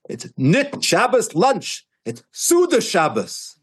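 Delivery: imitating the rabbi's slow, high-pitched insistence; emphatic correction. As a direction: draw out each word in emphatic, acted-out protest. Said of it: "That's how he said it".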